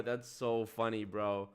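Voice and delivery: normal talking voice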